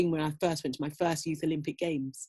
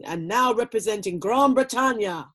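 stadium announcer; 5 semitones higher, 4 dB louder than usual. Retold or imitated. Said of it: "Then they say".

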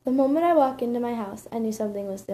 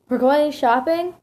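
loud, high, drawn-out excited question; stretched, rising delight; 6 semitones higher, 9 dB louder, slower than usual. Acted. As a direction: ask it in thrilled disbelief. Teen thrilled at shopping trip.

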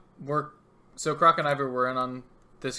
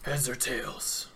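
slow, softer gruff villain; gruff Two-Face; 5 dB quieter, much slower than usual. Acted. Villain putting a decision to the coin, gruff. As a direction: draw it out like a gruff crime boss. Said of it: "trying to do a gruff voice".

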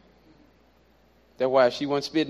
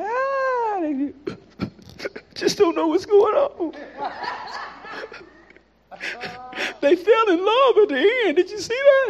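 high, giddy voice laughing and crying over a love story; gushing moviegoer; 4 dB louder than usual. Retold or imitated. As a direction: gush like a fan moved to tears by a movie. Mocking women gushing over movie.

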